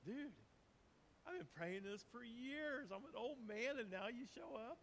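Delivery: high, exasperated, disbelieving; casual disbelief, way up in pitch; incredulous Zechariah. Retold or imitated. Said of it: "what was his first response? Like".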